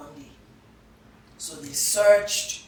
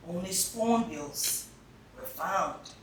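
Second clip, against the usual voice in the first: ordinary delivery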